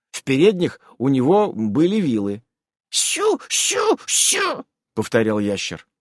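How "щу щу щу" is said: very high chirping squeak; lizard chirp. Acted. Lizardman repeating his sound, very high squeak.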